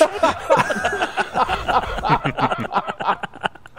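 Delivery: high, breathless laughter; real laughter after the punchline; long laugh. Real, not acted. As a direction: crack up at the story. Laughing at own story.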